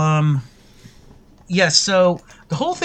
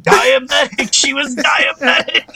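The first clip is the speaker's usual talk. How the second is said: high, half-hearted joke shout; mock yell; 7 semitones higher than usual. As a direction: pretend to yell out a cover story. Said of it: "they should've just yelled".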